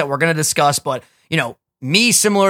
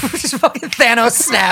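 laughing; 7 semitones higher, slower than usual. Real, not acted. Joking about Thanos ending everything.

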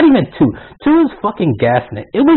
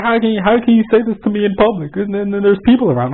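imitating a protesting coworker, pitched up; mock indignant complaint; 7 semitones higher than usual. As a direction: lightly mimic an offended objection in a higher voice. Coworker's public complaint acted out.

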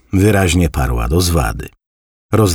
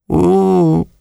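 high, drawn-out grandmother's "u"; impressed grandma; 5 dB louder than usual. A narrator's reading. Grandmother reacts to her grandson's news, voiced high. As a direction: sound impressed, peering over glasses.

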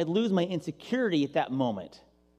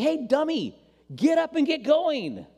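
mock impatient God; 8 semitones higher, slower than usual. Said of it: "God's like".

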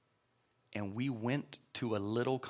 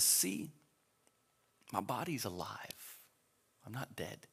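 soft-spoken Jesus; 2 semitones lower, 6 dB quieter than usual. Retold or imitated. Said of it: "Jesus comes to Thomas and says".

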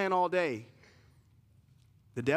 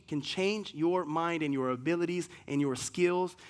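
usual speaking voice

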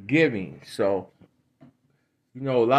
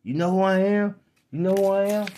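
higher, self-important brag; mocking celebrities who think they matter, in a higher bragging voice; mock celebrity; 7 semitones higher than usual. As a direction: puff up like a vain star. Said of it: "What they do?".

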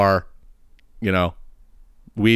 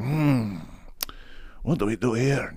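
softer, musing puzzled mumble; mock pondering; 10 dB quieter, slower than usual. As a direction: wonder aloud, stumped. Two men puzzled at hand.

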